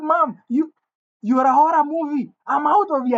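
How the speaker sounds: high-pitched exasperation; acted-out protest from a fed-up son; indignant outburst. Son walks out on the mother beating him, indignant.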